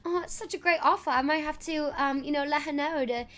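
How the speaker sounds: light posh British assistant voice. Pretend. Assistant touring for Miss Chelsea, lightly posh British. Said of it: "I'll pretend to be an assistant".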